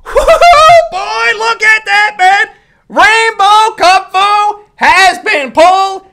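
high, loud whooping over a great pull; excited whoop. Real, not acted. Pack opener whooping at a rainbow pull, loud and thrilled.

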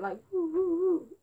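high hooting woo noises; a silly high hoot, wavering; mock ghostly hooting, a touch strained; horrifying noise. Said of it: "That was a horrifying noise".

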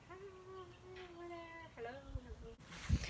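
high, distant, drawn-out call; far-off call. Shown as a demonstration. Reviewer testing mic range across the room, distant call. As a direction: call faintly from far away.

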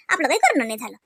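ordinary talk

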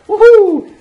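high, loud woohoo; shrill playful yell; mock cheer. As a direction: whoop it out like a hyped trainer. NPC cheer read aloud.

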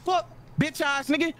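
ordinary talk

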